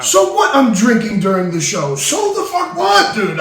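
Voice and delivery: so what, I'm drinking; indignant outburst. Acted. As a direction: bristle at being called out. Drunk host snapping at cohost.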